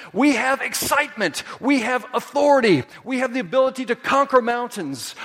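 high, ringing declaration building phrase by phrase; fervent, high-pitched proclamation to the church; impassioned preaching. Real, not acted. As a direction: preach it with rising fervor.

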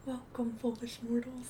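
hushed, eerie ghost host greeting; a hint of a creepy ghost host; spooky ghost host. Ghost host quote, Haunted Mansion.

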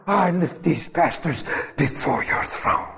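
breathy, mock-official intercessor prayer; put-on pious praying voice; spiritual voice. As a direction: intone it like a self-important intercessor. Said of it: "that makes it powerful and official".

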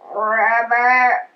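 low rolled ribbit; low frog croak. A narrator's reading. Pig replies to the puzzled frogs, low croak. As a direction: croak the word deep in the throat.

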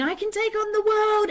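mock bravado. ADHD high swinging to the world, mock bravado. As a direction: puff up like nothing can stop you. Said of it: "swing us like whiplash between".

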